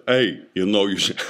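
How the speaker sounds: put-on light Bronx tough-guy accent; a hint of a Bronx wiseguy urging him on. Genitalia egging him on in a light Bronx accent. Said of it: "apparently my penis is from the Bronx".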